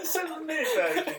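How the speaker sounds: very high, squeezed out through giggles; laughing. Real, not acted. Naming the short highlights version to co-host, laughing. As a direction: squeal it while cracking up.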